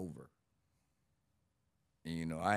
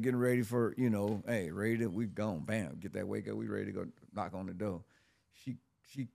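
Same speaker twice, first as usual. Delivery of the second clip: ordinary delivery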